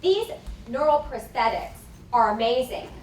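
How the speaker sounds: usual speaking voice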